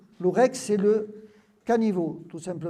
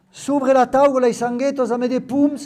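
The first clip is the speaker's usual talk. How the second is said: Occitan menu read out, louder; lecture en occitan; 4 semitones higher, 7 dB louder than usual. Acted. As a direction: read the list of dishes in patois. Lit un texte occitan. (lecture appuyée en occitan)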